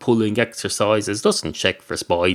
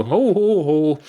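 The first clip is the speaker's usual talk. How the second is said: much higher hammed-up ho ho ho; mock laugh; 7 semitones higher, slower than usual. Customer laughing at his own quip to the barman, mock laugh. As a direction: fake a pompous chuckle.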